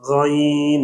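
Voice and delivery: غین said with the vowel in the nose; wrong demo of غین, nasal vowel; nasalized vowel. Shown as a demonstration. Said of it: "ناک میں آواز جا رہی ہے".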